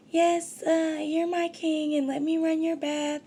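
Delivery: high-pitched mock adoring girlfriend; fawning woman. Woman flattering her king to run a game on him, high mock fawning. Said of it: "she was laying this shit on thick".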